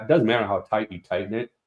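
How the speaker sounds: ordinary talk, nothing marked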